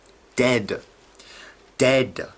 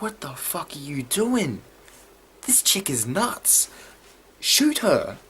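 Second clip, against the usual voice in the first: mock panic, pitched high; acted freak-out; 6 semitones higher, slower than usual. Imitating Tyrese reacting to a crazy girl, high mock panic. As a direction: act out someone freaking out, high-voiced. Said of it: "freaking the hell out".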